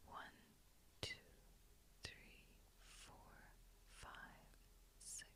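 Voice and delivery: barely audible, spaced-out counting; hushed breath count. Acted. Guide counts a listener's inhale, very hushed. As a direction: count very faintly, leaving room to breathe.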